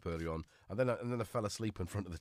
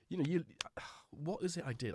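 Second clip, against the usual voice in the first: ordinary delivery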